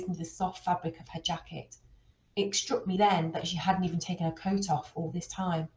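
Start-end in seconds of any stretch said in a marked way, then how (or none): none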